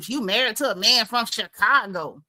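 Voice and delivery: mimicked dig, stretching out "Chicago"; pointed emphasis on Chicago. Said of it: "I don't like how you said that".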